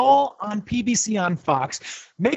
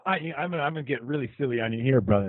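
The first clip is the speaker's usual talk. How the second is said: underwater; 7 semitones lower, 7 dB quieter, faster than usual. Real, not acted. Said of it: "You sounds like you're underwater".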